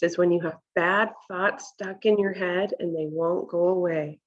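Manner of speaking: ordinary delivery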